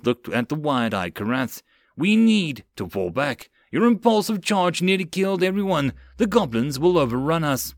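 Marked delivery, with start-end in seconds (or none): 2.0-7.7 forceful, raised-voice warning; urgent arguing. Acted. Sergeant to lieutenant about falling back, urgent. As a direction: press the case hard.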